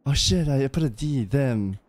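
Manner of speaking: normal talking voice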